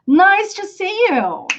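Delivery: slow, high, drawn-out model for repeating; slow language-teacher delivery; slow model phrase. Shown as a demonstration. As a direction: say it slowly and brightly for learners to echo. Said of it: "repeat after me".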